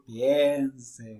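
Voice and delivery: dark, ominous horror-host voice; spooky voice. Shown as a demonstration. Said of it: "Y con voz tenebrosa decir".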